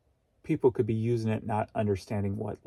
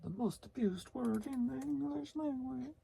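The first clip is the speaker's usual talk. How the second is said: higher, softer voiced-up title read; mock announcer; 14 semitones higher, 8 dB quieter than usual. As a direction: announce the topic in a put-on voice. Reading the topic in a voice.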